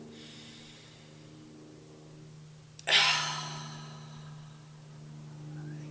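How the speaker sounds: long deep breath, then sighed out; big meditation exhale; demonstrated sigh. Shown as a demonstration. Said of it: "So something like this".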